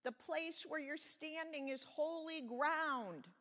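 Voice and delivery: slow, high, grand proclamation; thundering voice. A narrator's reading. God warns Moses about holy ground, slow thundering proclamation. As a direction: proclaim it slowly, swelling to the end. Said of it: "the voice thundered".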